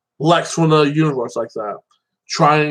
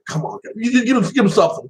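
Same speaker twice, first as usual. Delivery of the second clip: higher-pitched playful protest; mock pleading; 7 semitones higher than usual. Viewer urging the heroes to hit back, mock pleading. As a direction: plead lightly, pitch jumping up. Acting out own plea to the heroes.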